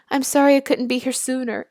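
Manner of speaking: ordinary talk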